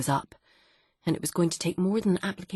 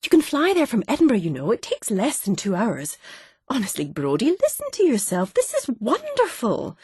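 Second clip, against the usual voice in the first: brightly reassuring; 7 semitones higher than usual. Acted. Wife cheerfully talking husband down.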